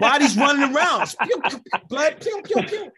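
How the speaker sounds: high, loud laughing pew sound effects; spray the pews like a cartoon; mock running sound effects. [name] telling Stan about the headless chicken, high laughing pews.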